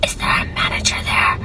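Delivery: faked raspy whisper, barely voiced; mock sore-throat whisper. Caller faking a sore throat to the cashier, whispered. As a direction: whisper like you can't use your voice.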